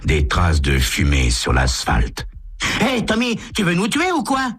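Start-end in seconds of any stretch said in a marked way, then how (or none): 2.8-4.5 put-on high, lighter boy's voice; a jittery teenager jolted in the seat; young passenger protesting, voice pitched much higher; Walt's teen voice. Walt voiced in the car.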